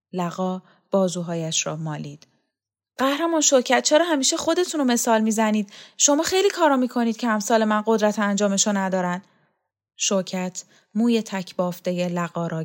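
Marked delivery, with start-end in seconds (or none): 3.0-9.2 higher, quick-running complaint; protesting underling. Acted. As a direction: plead with the boss, objecting. (اعتراض زیردست با صدای زیرتر)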